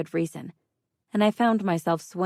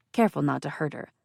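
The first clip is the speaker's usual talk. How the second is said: usual speaking voice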